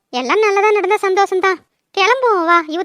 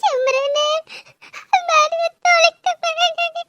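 high, soft tearful wail; crying; 6 semitones higher, 6 dB quieter, slower than usual. Acted. Woman crying to her friend Simran, words lost in tearful wailing. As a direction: sob through slow, garbled words. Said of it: "செத்த அழுவாம பேசு".

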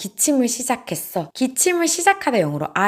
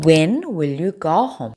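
slow model sentence; 3 semitones lower, much slower than usual. Shown as a demonstration. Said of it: "when을 앞에 붙여주시면 돼요".

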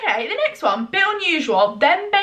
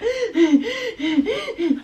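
high, wheezy gasps in a row; mock heavy breathing; 10 semitones higher than usual. Asking why boys breathe like this, mock panting. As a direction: imitate someone struggling for breath, exaggerated. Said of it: "why you're going".

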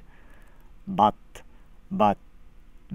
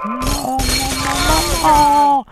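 put-on whiny little kid; 3 semitones higher, 6 dB louder than usual. Child begging daddy not to make him go, whining. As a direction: wail no like a kid being dragged off. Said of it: "I've actually heard little kids talk like that before".

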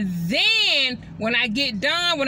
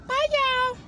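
put-on higher cheery bye, y'all for the toddler; high sing-song bye; 11 semitones higher than usual. Shown as a demonstration. Prompting her toddler to wave goodbye, high and cheery. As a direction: model it for the child to copy. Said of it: "Stick your head in here and say".

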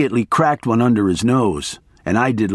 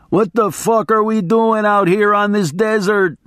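high, lightly raised mock shout; 9 semitones higher than usual. A narrator's reading. Attorney's high mild shout in the desert. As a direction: yell it up at the desert sky. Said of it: "he shouted".

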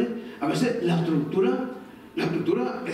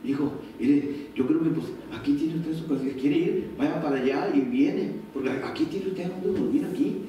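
kind parent; 7 semitones lower than usual. Retold or imitated. Parent welcoming son home, gentle kind tone. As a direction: reassure the son kindly, no pressure. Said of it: "Con amabilidad, con bondad".

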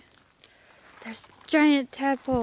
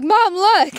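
imitated excited shout, high and loud; excited calling out; 9 semitones higher, 10 dB louder than usual. Retelling how Marsh called to mom, excited shout. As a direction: mimic a kid hollering for attention.

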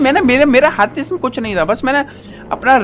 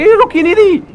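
high, loud cry of frustration; frustrated yell; 11 semitones higher, 6 dB louder than usual. Real, not acted. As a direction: yell at the car that won't stop. Car won't stop, frustrated yell.

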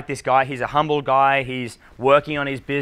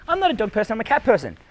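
put-on lightly raised girl's voice; a hint of a girl insisting; 7 semitones higher, faster than usual. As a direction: play the girl, a bit higher. Girl voiced in acted bar scene.

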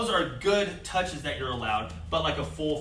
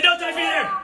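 real loud; 12 semitones higher, 7 dB louder than usual. Shown as a demonstration. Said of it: "be real loud for me".

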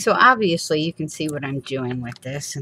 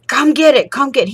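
loud, higher urgent calling; panicked calling; 10 semitones higher, 8 dB louder than usual. Retold or imitated. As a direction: call for help in a panic. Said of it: "I'm like".

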